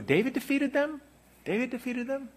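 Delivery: doubting listener; incredulous. Retold or imitated. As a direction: question it in disbelief.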